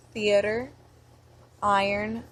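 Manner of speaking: normal talking voice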